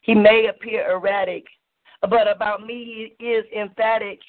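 measured rhythmic poem recital with dramatic pauses; spoken word poem. Acted. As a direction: perform it like a slam poem, savouring each line. Spoken-word love poem performance.

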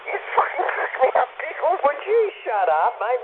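can barely get the words out for laughing. Real, not acted. To Pickles, cracking up at the jibe, laughing. Mate's jibe sets him off.

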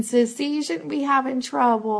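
usual speaking voice

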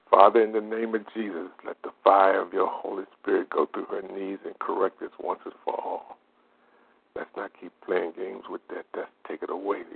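soft prayer fading to a whisper. Acted. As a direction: pray over her knees, growing hushed. Healing prayer for wife's knees.

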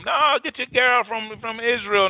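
high-pitched, urgent mock parental warning; pleading parents. Parents warning their son off a foreign girl, pleading. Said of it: "Parents told him".

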